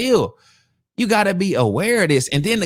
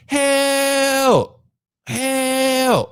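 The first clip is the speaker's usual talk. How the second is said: higher, drawn-out "Help! Help!"; mock yell for help; 7 semitones higher than usual. Mimicking the woman yelling at a stranger's door, higher calls. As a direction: imitate her calling out for help. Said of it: "Yelling it out".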